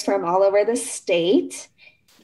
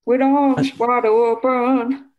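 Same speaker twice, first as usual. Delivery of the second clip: [name] singer impression; slower than usual. Shown as a demonstration. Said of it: "Try to make a [name] sound".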